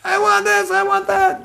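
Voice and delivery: very high-pitched imitation of a child's tantrum; mock tantrum shriek; screaming kid. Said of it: "her kid is screaming".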